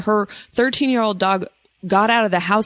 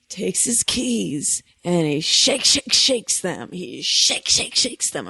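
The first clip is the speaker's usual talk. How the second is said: slow, lightly spooky storytelling; campfire story voice; slower than usual. Pretend. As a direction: draw it out like a tale by the fire. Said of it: "I'm trying to tell it like how people tell campfire stories".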